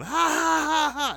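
high, loud mock cackle; imitated hysterical laughter. Rider imitates his friend beside him, loud hysterical laughing. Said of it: "laughing hysterically, going".

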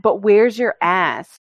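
slow, mocking taunt; slow mocking comment aimed at Brazilians; mocking quote. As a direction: drawl out the dumb question. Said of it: "they always get the comment".

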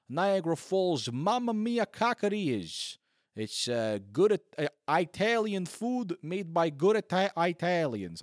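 a hammed-up Italian accent that keeps breaking; mock Italian accent. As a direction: stumble into an Italian accent on the restaurant words. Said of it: "I can't even say Italian wrong".

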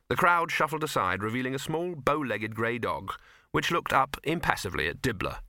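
ordinary delivery

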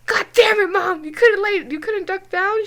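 angry daughter scolding mom; exasperated. Retold or imitated. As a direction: chew Mom out. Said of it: "I was like".

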